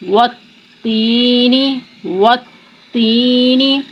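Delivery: والتین with the lips fully rounded on و, slow; والتین said slowly, rounded lips on the و; rounded waw. Shown as a demonstration. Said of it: "پوری گلائی کی آواز کیسے آئے گی".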